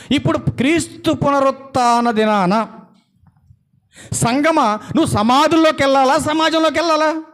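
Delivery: high, sustained pulpit delivery; forceful preaching. Real, not acted. Preacher asking church whether to go to graves or society, forceful preaching. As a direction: build a challenge to the congregation.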